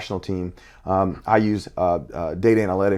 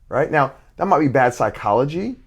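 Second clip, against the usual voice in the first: ordinary talk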